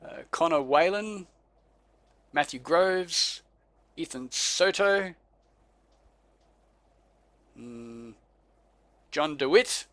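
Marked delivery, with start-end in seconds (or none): none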